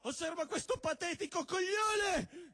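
scornful outburst. Acted.

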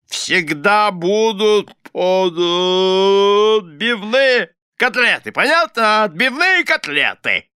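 high, loud, stretched-out bluster; blustering demand. A narrator's reading. Husband demanding cutlets from his wife, indignant bluster.

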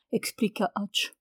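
ordinary delivery, nothing marked

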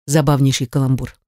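usual speaking voice

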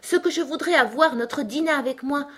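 high-pitched wishful exclamation; exclaiming aloud. A narrator's reading. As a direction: wish out loud to nobody in particular. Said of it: "s'exclama Alice à haute voix".

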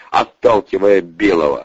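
usual speaking voice

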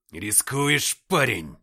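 loud growled warning. A narrator's reading. Captain to a young soldier under fire, growling. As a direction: growl it out at full voice. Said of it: "прорычал я".

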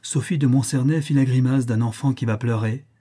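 plain reading voice

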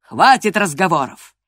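loud, angry cut-off; storming rage. A narrator's reading. Master cuts short the pleading, storming. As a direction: thunder the order, ending all talk. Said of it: "бушевал хозяин".

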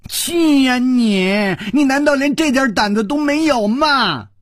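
high, loud, furious nagging; scolding wife. A narrator's reading. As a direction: hammer him with taunts. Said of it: "用拳头擂着他的胸膛说". (妻子捶着丈夫胸口怒骂)

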